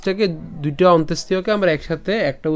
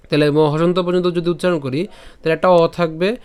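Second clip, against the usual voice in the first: ordinary talk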